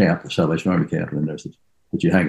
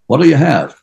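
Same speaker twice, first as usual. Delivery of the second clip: higher, louder demand from a game character; 9 semitones higher, 8 dB louder than usual. Shown as a demonstration. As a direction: bark it out like a tavern keeper sizing up a stranger. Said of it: "the next one is".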